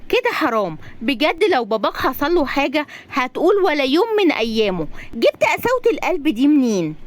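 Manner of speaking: high, scolding female-character voice; indignant young woman. Acted. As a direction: lecture him with rising indignation. Razan scolds Magdy in story.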